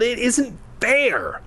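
long, high-pitched wail. A narrator's reading. Mia protesting that flying is unfair, wailing. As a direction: stretch it into a whiny wail. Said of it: "she wailed".

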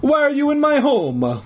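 put-on high-pitched, demanding Italian accent; indignant old Italian janitor; hoarse Italian voice. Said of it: "in his hoarse Italian voice".

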